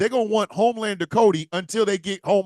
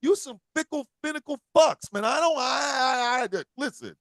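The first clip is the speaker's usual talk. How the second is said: imitating a fed-up Cena; 7 semitones higher than usual. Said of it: "I kinda feel like John Cena does".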